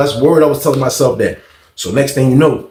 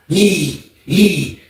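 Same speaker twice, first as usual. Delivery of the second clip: raised, separated "ee" squeals; imitated door kicking; 4 semitones higher than usual. Storyteller showing how inmates kick doors, imitated noise. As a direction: mimic each kick with a squeal. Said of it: "That's how dudes kick the door in jail".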